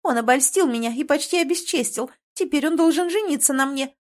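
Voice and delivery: high, mildly hammed-up wail; tearful. A narrator's reading. Girl demanding the prince marry her, mock tearful. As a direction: play the wronged victim, lightly tearful. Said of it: "начиная рыдать".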